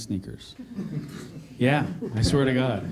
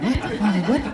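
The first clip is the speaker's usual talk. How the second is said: mock disbelief; 7 semitones higher, 3 dB louder than usual. Acts out disbelief at casting call.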